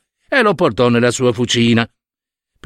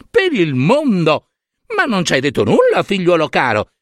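Hera, falsely delighted; 6 semitones higher than usual. Acted. Hera greeting her returned son with much higher, falsely overjoyed voice. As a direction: gush over him with a big fake smile.

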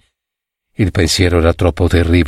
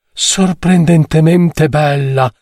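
high, slow, drawn-out admiration; admiring exclamation; 7 semitones higher, slower than usual. A narrator's reading. Second friend admiring the passing lady, drawn-out exclamation. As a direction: stretch it out in a raised character voice. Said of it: "esclamò un secondo".

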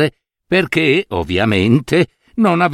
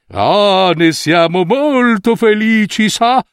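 pleased swindlers; 8 semitones higher, slower than usual. A narrator's reading. Weavers gloating to the fooled minister, high and pleased. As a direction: purr like tricksters who've won. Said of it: "dissero i due tessitori".

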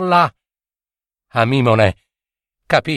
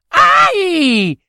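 sharp high yelps of pain; little satyr yelping in pain; pained ouch cries; 13 semitones higher than usual. Acted. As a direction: yelp as the arrow strikes.

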